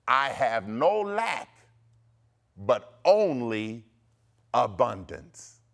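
slow, heavily spaced decree; declaration to repeat. Shown as a demonstration. Preacher giving listeners decree number six, declaring. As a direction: proclaim it with long weighty pauses. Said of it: "say this".

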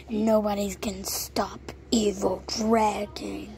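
whispered dragon villain; evil whisper. Acted.

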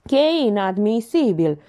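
pompous mild protest; indignant notary. A narrator's reading. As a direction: object like an offended official. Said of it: "comentă notarul".

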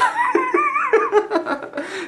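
a squealing high laugh of disbelief; real amused laughter. Real, not acted. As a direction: break into a high-pitched giggle. Laughs at the comment.

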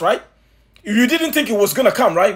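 ordinary talk, nothing marked

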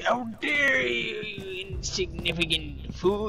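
slow, high mock-outraged old man; indignant old man. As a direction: imitate the grandpa's huffy outrage. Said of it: "And then he's like".

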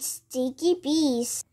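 ordinary delivery

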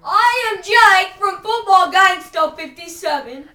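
loud, slow intro. Shown as a demonstration. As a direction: proclaim it like a show host. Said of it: "One more time".

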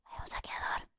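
hushed whisper so the looter won't hear; sneaky whisper. Real, not acted. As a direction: breathe it out, barely voiced. Sneaking past a looter in game.

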